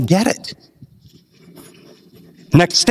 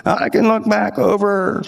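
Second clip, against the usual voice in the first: put-on slow, drawn-out old man voice, higher pitched; old man impression; 5 semitones higher than usual. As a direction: imitate an elderly man reminiscing slowly. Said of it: "he talked like this".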